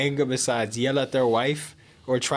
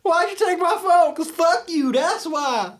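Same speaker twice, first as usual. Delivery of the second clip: mock American accent; 7 dB louder, slower than usual. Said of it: "what every other race can say about Americans".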